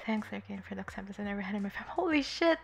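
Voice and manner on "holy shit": surprised. Real, not acted. Reacts to a touching chat message.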